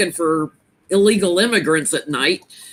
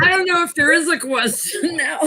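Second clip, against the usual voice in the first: after laughing hard; 9 semitones higher than usual. Real, not acted. Still laughing.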